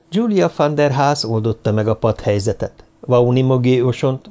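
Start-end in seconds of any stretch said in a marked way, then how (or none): none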